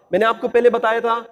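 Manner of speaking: usual speaking voice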